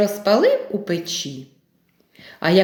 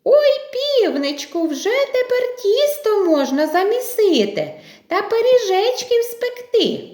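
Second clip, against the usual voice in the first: high, delighted little mice; 12 semitones higher than usual. Acted. Mice rejoice at the flour, high and delighted. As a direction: squeal with joy in a high mouse voice. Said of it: "пораділи".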